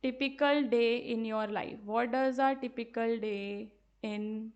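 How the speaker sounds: very slow, drawn-out words; slow dictation. Shown as a demonstration. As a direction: dictate the question for copying. Teacher dictates sample question.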